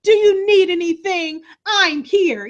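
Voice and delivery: high, slow mock-tender reassurance; mock caring daughter. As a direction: play a fake doting daughter. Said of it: "she does her".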